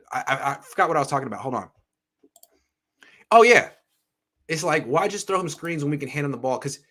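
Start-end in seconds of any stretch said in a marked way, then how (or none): 3.3-3.7 sudden recall. Real, not acted. Suddenly remembering his point.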